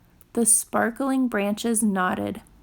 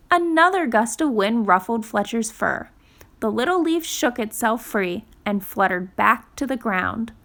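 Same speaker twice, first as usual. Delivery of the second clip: plain reading voice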